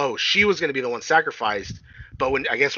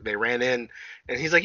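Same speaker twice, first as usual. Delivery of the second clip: ordinary talk, nothing marked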